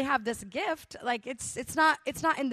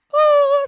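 loud, high mock wail of weeping; 14 semitones higher, 13 dB louder than usual. Mimicking the praying man breaking down, with a hammed-up wail. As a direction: let out a big hammed-up wail. Imitating the man's outburst.

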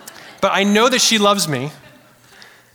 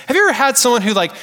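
normal talking voice

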